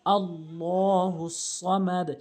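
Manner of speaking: slow "আল্লাহু সামাদ" with thick 'llaw' and heavy s, long vowels; full heavy pronunciation. Shown as a demonstration. Teacher showing the correct verse, heavy drawn-out recitation. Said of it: "এখানে পুর হবে".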